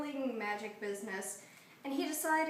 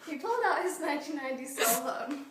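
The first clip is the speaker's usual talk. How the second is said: words breaking into laughter; can't hold back the giggles; half-laughing through the line; 3 semitones higher, 4 dB louder than usual. Real, not acted. Cracks up retelling the phone.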